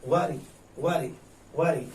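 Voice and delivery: "worry" said slowly and evenly, again and again; slow drilled word. Shown as a demonstration. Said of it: "इस word worry". ("worry" धीरे से दोहराना)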